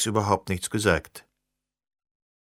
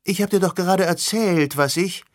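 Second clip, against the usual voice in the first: protesting woman voice; 7 semitones higher than usual. Acted. Sirona protesting, cut off.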